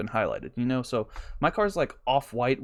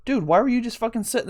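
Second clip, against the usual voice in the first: exasperated rant; 8 semitones higher, faster than usual. Retold or imitated. Ranting at the driver.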